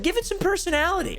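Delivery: high mocking complaint; mocking whiny voice. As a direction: mimic whiny critics. Narrator mimics complaining viewers.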